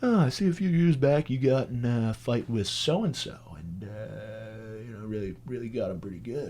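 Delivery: lightly voiced cop, insinuating quietly; cop role. Cop bringing up an old fight from the records, mock cop voice. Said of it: "he rolls up and he goes".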